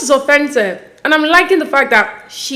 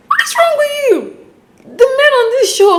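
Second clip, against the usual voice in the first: high-pitched exasperated cry, far above usual voice; exasperated outburst; 14 semitones higher than usual. Real, not acted. Host ranting at the men on the show, exasperated. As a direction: burst out in squeaky outrage.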